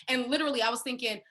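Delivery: ordinary delivery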